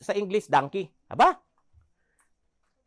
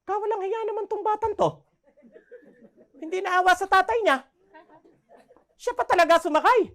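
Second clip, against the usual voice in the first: higher, gossiping outraged voice; higher mock-outraged gossip, with a laugh at the end; scolding townsfolk; 10 semitones higher, 4 dB louder than usual. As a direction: scold the boy as nosy bystanders. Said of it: "Sabi ng mga tao".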